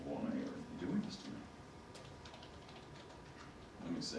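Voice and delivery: ordinary talk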